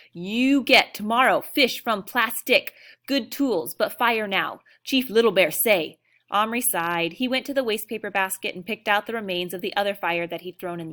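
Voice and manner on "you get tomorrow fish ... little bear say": loud, slow chief's order; commanding chief. A narrator's reading. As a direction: decree it as Chief Little Bear. Little Bear gives orders.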